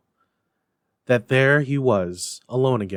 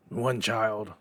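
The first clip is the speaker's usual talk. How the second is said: Scrooge returning; 4 dB quieter than usual. A narrator's reading. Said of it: "Scrooge returned".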